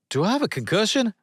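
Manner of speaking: high, fast disbelief; can't believe his ears, rushing; incredulous. A narrator's reading. Sonny reacting to ice football in quick high disbelief.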